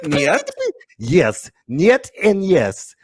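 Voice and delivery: slow, drawn-out Russian "Niet and yes"; Russian accent. Pretend. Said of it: "like a Russian dance D J".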